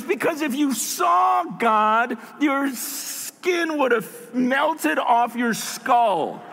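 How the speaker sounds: acted, forceful slow explanation; emphatic retort. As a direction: stretch the key words for dramatic weight. Acted retort in retold argument.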